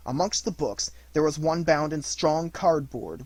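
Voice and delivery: ordinary delivery, nothing marked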